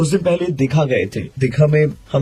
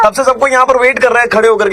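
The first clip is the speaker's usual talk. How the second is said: high, loud indignant complaint; annoyed pretend caller; 10 semitones higher, 7 dB louder than usual. Prank caller loudly scolding the woman for not showing up, high and loud. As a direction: scold her like a fed-up friend waiting.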